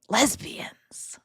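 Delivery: breathy conspiratorial whisper of one word; whispered aside. Real, not acted. Streamer teasing chat about a TV episode, whispering. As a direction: whisper it like a secret.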